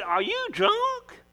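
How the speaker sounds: incredulous priest. Acted. Priest questions crying woman.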